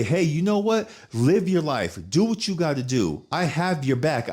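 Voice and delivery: high, emphatic pep talk; imagined encouragement. Retold or imitated. Voices the support he longs for.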